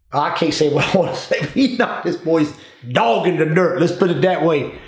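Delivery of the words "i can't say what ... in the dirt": ordinary delivery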